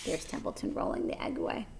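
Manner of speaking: soft whispered aside. Real, not acted. Pointing out the picture to a child, whispered. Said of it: "aside to listener".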